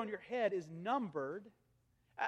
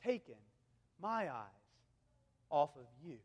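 soft, low, unhurried reassurance; tender voice of God; 7 semitones lower, 9 dB quieter than usual. Acted. God assuring his anxious children, soft and low. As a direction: lean in and promise quietly. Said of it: "God says".

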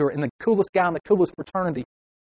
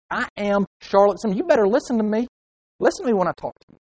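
raised-pitch, self-important scolding; acted huffy telling-off; indignant demand; 5 semitones higher than usual. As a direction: insist on being heard. Acted person not being listened to.

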